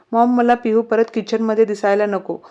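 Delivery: usual speaking voice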